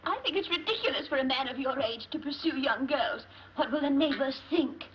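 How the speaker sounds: tearful scolding. Acted. Wife scolding husband tearfully.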